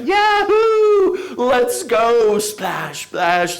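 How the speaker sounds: high excited whoops of friends jumping; delighted whoops. Retold or imitated. Friends jumping into the water, high gleeful whoops. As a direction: shout it like kids leaping off a cliff. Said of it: "screaming with delight".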